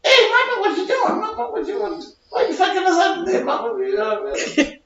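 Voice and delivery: put-on very high baby voice cracking into laughs; high laughing baby voice. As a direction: tease mama in a squeaky baby voice, giggling.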